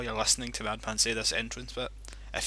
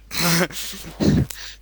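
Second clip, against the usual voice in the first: sudden burst of laughter; cracking up loudly; 8 semitones higher, 12 dB louder than usual. Real, not acted. Friend laughing hard.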